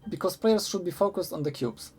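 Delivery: ordinary talk, nothing marked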